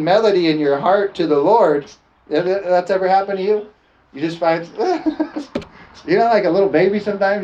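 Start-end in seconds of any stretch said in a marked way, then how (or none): 4.7-5.6 high burst of giggles; giggly laugh. Acted. Preacher shows congregation being overcome by the Spirit, giggly. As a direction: giggle helplessly like a little baby. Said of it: "you just get giggly".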